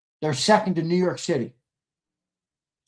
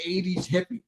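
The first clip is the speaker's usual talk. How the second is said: ordinary talk, nothing marked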